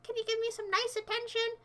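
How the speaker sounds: hammed-up needy plea; needy whiny voice. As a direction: beg for attention mockingly. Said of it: "you can just say".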